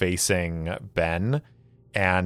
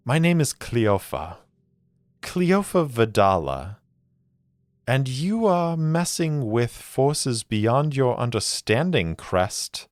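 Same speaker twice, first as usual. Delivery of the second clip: 1 menacing woman; 6 semitones higher than usual. Acted. Villainess threatens Ben through dagger.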